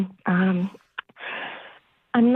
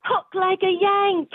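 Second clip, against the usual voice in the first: higher-pitched put-on British; fake British accent; 5 semitones higher than usual. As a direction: explain it away in fake British. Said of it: "drop the British thing".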